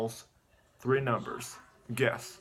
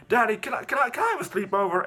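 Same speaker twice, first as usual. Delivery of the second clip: put-on high, louder little-boy request; pleading child; 9 semitones higher, 5 dB louder than usual. Boy asking his dad for a sleepover, high and eager. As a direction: imitate a kid begging Dad.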